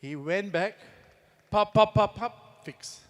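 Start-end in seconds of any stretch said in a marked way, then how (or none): 1.5-3.0 popping sounds. Retold or imitated. Imitating the son's actions.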